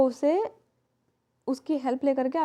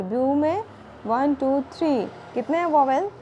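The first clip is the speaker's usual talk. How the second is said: usual speaking voice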